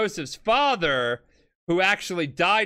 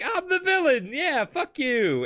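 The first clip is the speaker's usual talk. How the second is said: mock gloating villain; 11 semitones higher than usual. Cars quoted, gloating villain.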